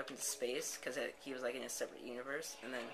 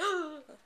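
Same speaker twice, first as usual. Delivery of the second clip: sharp, loud intake of breath; exaggerated gasp of mock shock; 6 dB louder than usual. Brian shocked in story.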